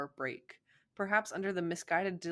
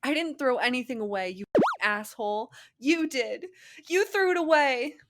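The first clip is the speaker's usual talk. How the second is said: furious scream; 8 semitones higher, 9 dB louder than usual. Acted. Girlfriend screaming at boyfriend.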